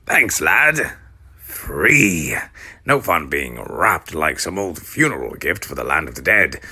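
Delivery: put-on urgent gravelly old mummy; hurried old guide, loud. Mounshroud's gruff character voice.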